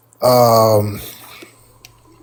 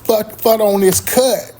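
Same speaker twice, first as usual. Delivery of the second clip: mimicking girlfriend; 8 semitones higher, slower than usual. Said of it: "she was like".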